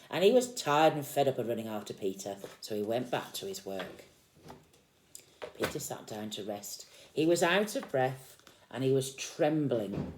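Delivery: put-on lively read-aloud to a small child; storybook reading. A narrator's reading. Parent reading Peter Rabbit to a child, lively storytelling. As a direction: read the picture book out with energy.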